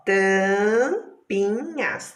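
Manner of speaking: tampinhas slowly split as tam, pi, nhas; very slow, segmented tampinhas; syllables separated. Shown as a demonstration. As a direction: stretch out each syllable of tampinhas. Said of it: "olha lá, separadas".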